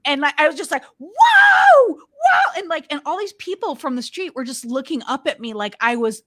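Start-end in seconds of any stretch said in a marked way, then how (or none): 1.0-2.5 high-pitched celebratory scream; screaming whoop. Retold or imitated. Screaming out the window to people in the street, wild whooping. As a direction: whoop loudly out of an open window. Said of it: "just started screaming".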